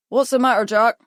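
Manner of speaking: gravelly call. A narrator's reading. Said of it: "he called down in a gravelly voice".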